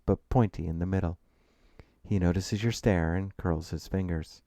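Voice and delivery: usual speaking voice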